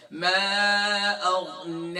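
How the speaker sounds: slow, elongated tajweed recitation; measured teaching recitation on a level note; Quran recitation. Shown as a demonstration. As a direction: recite it with long held vowels. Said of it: "اب اسی آیت کو complete کرتے ہیں".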